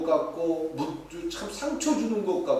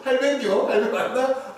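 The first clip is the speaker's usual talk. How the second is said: high, loud Gyeongsang exchange, half laughing; Gyeongsang dialect; 8 semitones higher, 5 dB louder than usual. Shown as a demonstration. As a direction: toss out two short Gyeongsang questions. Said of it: "이런단 말이에요". (높고 짧게 주고받는 경상도 사투리)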